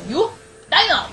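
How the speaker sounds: high, loud yell at a monster; playful yell. Acted. Gamer yelling at a dinosaur enemy, loud playful shout. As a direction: bellow the nickname at the creature.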